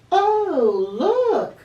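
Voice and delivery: put-on high, loud excited little boy; excited cry. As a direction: cry out with delight. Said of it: "cried Henry".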